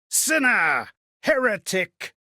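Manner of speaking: loud accusing cry; accusing shout. Acted. Mob shouting at the accused in the courts. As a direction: hurl the words like an angry crowd.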